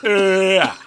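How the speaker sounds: mumbling action hero with numb mouth; numb-mouthed Stallone. Pretend. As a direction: grunt through a numb jaw after the dentist. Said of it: "I am Sylvester Stallone".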